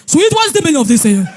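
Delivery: loud, sharp demand for an answer; husband's angry rebuke. Retold or imitated. Husband confronts his wife over the scratched car, loud and angry. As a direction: snap at her over the scratch. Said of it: "Oh, sweet".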